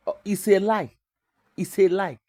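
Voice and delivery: normal talking voice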